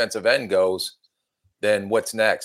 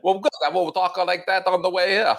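high, hammed-up English accent; wobbly mock English accent in a high voice; bad English accent; 9 semitones higher than usual. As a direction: attempt an English accent and miss it comically. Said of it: "better than your English accent".

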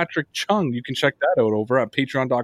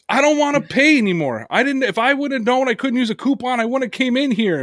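imitating a whiny customer, pitched up; mock the complainer with a high whine; 9 semitones higher than usual. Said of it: "And he goes".

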